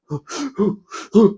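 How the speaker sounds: imitated hard, gasping breaths; mimicked panicky sleep-breathing. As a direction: gasp like someone stuck in a nightmare. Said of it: "breathing really hard".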